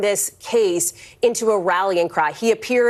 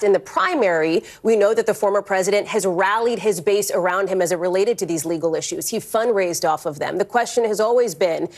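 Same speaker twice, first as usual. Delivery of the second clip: usual speaking voice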